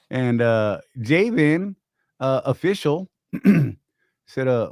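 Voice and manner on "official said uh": normal talking voice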